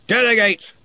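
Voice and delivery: loud curt command; booming order. Acted. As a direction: fling the word back. Ridcully orders curtly.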